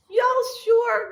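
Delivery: high disbelieving y'all sure. Retold or imitated. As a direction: squeak it out in disbelief. Doubting friends' advice.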